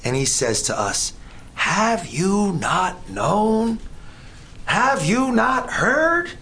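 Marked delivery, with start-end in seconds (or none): 1.6-6.3 very slow, every word drawn out; slow emphatic reading. Shown as a demonstration. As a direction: stretch each word as a weighty question. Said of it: "Why does he ask for the second time?".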